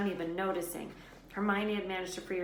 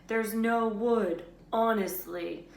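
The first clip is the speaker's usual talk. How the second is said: slow, louder mocking mimic; 4 semitones higher, 8 dB louder, slower than usual. A narrator's reading. Ron mimics Hermione's panic, slow mocking imitation. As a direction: imitate her panic with scorn.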